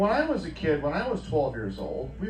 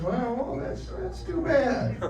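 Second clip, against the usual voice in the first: higher, slow, laughing fake pity; mock sympathy; 9 semitones higher, slower than usual. Comedian mocking his exes' misfortune with fake pity. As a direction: dismiss it with hollow sympathy.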